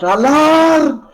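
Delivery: high, loud alarm cry; alarmed shout. Acted. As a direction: raise the alarm in panic. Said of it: "Callus would be like".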